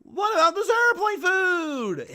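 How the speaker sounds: high, slow stand-up whine; Jerry Seinfeld airplane-food delivery; Seinfeld impression. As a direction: ask it like Seinfeld's classic bit. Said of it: "He always ends every sentence like that".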